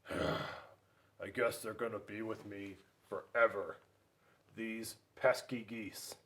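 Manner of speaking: resigned grumpy bear. Acted. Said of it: "pretty much decided".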